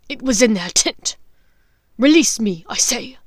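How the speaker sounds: loud beastly protest; angry werewolf. Acted. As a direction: bark it back at her. Greyback shouting.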